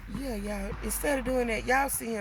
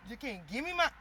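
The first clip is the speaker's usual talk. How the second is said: scolding; 8 semitones higher, 5 dB louder than usual. Real, not acted. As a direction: yell at him to hand over the shoe. Scolding someone taking her shoe.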